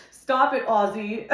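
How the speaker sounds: high, loud laughing stop it; laughing protest. Real, not acted. Reader laughing at Ozzy's spirit, high and loud. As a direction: shoo Ozzy off through laughter. Emotional reaction to Ozzy.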